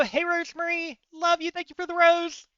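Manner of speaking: high, sing-out fan greeting; gushing thanks. Real, not acted. Player thanking a viewer for the rose, gushing and high.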